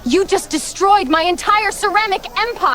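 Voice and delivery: high indignant outburst, pitched well up; outraged cry. Acted. Princess crying out at the rider who smashed her pottery. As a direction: wail at the ruin of your pots.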